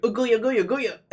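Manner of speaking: high, loud gibberish parody of an angry dub line; loud comic imitation of a dubbed rant; mocking the dub actor. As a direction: ham up the shouted gibberish. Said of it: "He's like".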